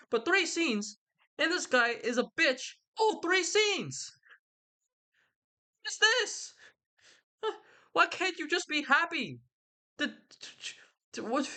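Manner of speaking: very high-pitched, incredulous outburst; exasperated rant. Real, not acted. Reader ranting about a hated character, exasperated. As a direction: let the voice shoot way up in exasperation.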